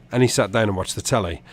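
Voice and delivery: usual speaking voice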